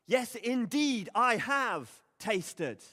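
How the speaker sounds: booming, drawn-out proclamation; grand declaration. Acted. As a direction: announce it like a grand confession. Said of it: "as if he's about to make some grand declaration".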